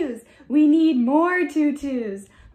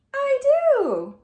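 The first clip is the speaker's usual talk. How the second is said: high character voice; 9 semitones higher, 5 dB louder than usual. Acted. Toucan character answers.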